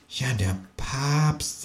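soft, slow, ominous agreement; hushed, drawn-out kangaroo line; quiet. Acted. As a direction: say it slowly under the breath. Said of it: "sagt das Känguru leise".

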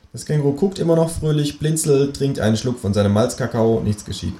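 ordinary delivery, nothing marked

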